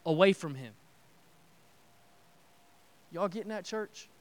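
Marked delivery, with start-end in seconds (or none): 3.1-4.1 soft, dropped check-in to the congregation; quiet aside. Real, not acted. Preacher quietly checks that the church follows, softly. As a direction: ask it gently under your breath.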